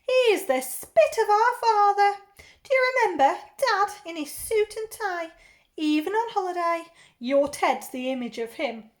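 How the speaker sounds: loud, high auntie chatter; big-voiced, gushing aunt; effusive aunt. Aunt remarks on nephew.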